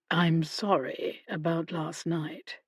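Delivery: "I'm sorry about last night" said low and soft. A narrator's reading. Mister Loudon apologises to Hannah quietly, low and soft voice. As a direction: drop the voice, slow and soft. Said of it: "He lowered his voice".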